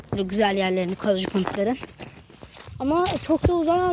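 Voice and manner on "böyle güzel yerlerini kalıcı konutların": usual speaking voice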